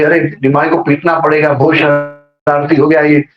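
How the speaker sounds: ordinary talk, nothing marked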